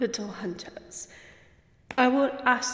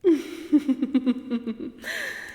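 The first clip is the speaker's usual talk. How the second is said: mocking laugh; 2 semitones higher, 3 dB louder than usual. Vampire countess laughs at hunters.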